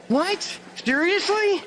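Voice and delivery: high, incredulous yelps into the phone; sudden outrage at the news; shocked disbelief. Acted. Bad news on phone call.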